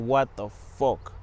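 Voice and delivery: a slow, stretched-out what the fuck; mock bewildered groan, slowed right down; drawn-out exasperation. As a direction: drag out every word of "What the fuck?" in weary disbelief. Waking a third time.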